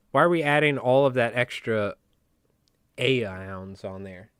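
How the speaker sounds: normal talking voice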